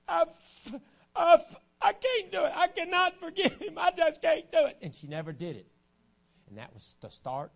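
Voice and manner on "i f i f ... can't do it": much higher and much louder, strained and breaking; tearful stammer. Retold or imitated. Preacher acting out the weeping girl, high strained wail. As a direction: cry out her stammered refusal in a strained wail.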